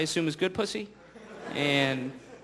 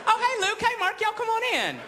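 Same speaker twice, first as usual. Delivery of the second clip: much higher camp Southern greeting; high, louder Southern camp hostess voice; effeminate Southern Jesus; 11 semitones higher, 4 dB louder than usual. Acted. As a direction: gush as you welcome the apostles in. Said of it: "like a really effeminate Southern guy".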